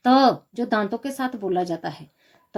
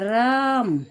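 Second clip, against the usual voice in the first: राम with the आ held long; prolonged vowel; much slower than usual. Shown as a demonstration. Teacher demonstrates the three-beat vowel for pupils, prolonged राम. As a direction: stretch out the आ in राम like a call. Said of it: "तीन मात्राओं का समय लगता है".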